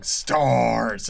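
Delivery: loud monster bellow; Nemesis growl. Pretend. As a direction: roar it like a lumbering monster. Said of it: "you're just going around going".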